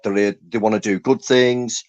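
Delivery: ordinary delivery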